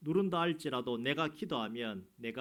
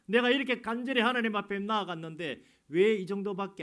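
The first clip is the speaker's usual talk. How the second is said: higher and louder, urgent sermon push; fervent preaching; 7 semitones higher, 8 dB louder than usual. Real, not acted. Pastor urging earnest seeking, loud and pleading. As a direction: press the plea with rising urgency.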